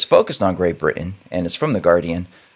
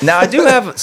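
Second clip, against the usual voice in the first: mock British; 12 semitones higher, 5 dB louder than usual. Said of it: "stereotypical, problematic British accent".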